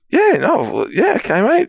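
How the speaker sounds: high, confused stammering; flustered reply. Retold or imitated. Caught out by the stranger, flustered and high. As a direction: fumble for words, caught off guard. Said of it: "And I'm like".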